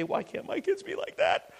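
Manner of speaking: envious parent. Retold or imitated. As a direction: voice a worn-out parent wishing aloud, high and soft. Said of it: "parents ... look at that and say".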